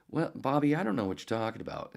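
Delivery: exasperated host, pitched high then easing down; Phil Hendry. Retold or imitated. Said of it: "and Phil, you know".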